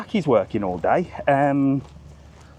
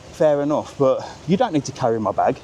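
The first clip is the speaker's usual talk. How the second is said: normal talking voice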